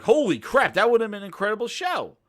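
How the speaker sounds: loud imagined rave, picking up speed; excited reaction. Acted. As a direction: blurt it like a thrilled fan. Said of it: "I would have been like".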